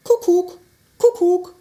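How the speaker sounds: kukuk sung out high and loud, falling; cheerful cuckoo call from a clock; cuckoo call imitation. Shown as a demonstration. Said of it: "se ääni on kukuk".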